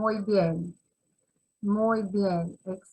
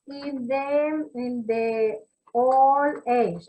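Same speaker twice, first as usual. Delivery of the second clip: slow dictation; much slower than usual. Shown as a demonstration. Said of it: "teacher dictating, words stretched out".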